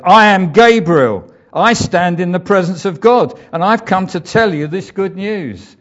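chest out, a hint of an angel's authority; proud archangel. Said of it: "drew himself up to his full height".

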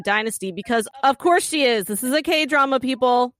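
scripted, punchy show introduction with playful asides; lively scripted host narration; podcast intro read. Acted. Reads scripted podcast plot summary.

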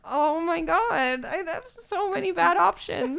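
slow, drawn-out mock despair; dismayed. Stuck choosing between bad outfits, slow dismay. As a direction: drag it out in disbelief.

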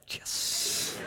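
whispered secret yes of glee; gleeful whisper. Retold or imitated. Preacher mocking a listener's secret glee, whispered. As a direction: hiss a private little victory. Secretly glad friend's beach trip rained.

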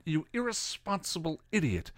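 high, contained outrage without volume; indignant rebuke; angry scolding. A narrator's reading. Giles scolds Spike in a quarrel.

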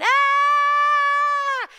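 loud high mock scream. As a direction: scream at the bear. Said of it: "they're all going".

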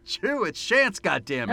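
high, loud protest at being forgotten; hammed-up indignant reminder; mock exasperation. Co-host reminds forgetful friend who they are, mock exasperated.

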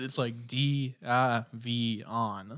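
slow letter by letter "D I V on"; "D I V on" spelled letter by letter, slowly; slow spelling out. Shown as a demonstration. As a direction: read out each letter slowly. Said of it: "looking at it".

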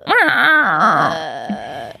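strained croaky cat cry; old cat meow. Retold or imitated. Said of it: "funny when he tries to meow".

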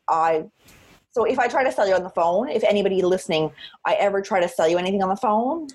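ordinary talk, nothing marked

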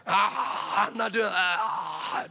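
drawn-out mock demonic growl-cry; demon manifesting. Woman manifests a demon on the couch, mock possessed. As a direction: imitate a possessed woman's long outburst. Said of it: "she started manifesting a demon".

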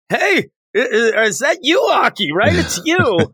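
high, stammering imitated call; mock timid yelling. Podcaster as Power yelling from behind a corner, mock nervous shout. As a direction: call out high, bravely but from hiding. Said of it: "she's yelling from almost behind a corner".

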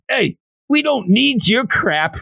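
high, brash puppet voice; hammed-up old-man puppet heckling; Bigo. Puppet butts in on the captain argument, high brash puppet voice.

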